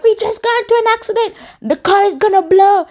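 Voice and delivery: very high, fast frantic phone call; high, loud alarm, words tumbling out; panicked. Retold or imitated. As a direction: blurt the emergency in a panic. Second panicked call after crash.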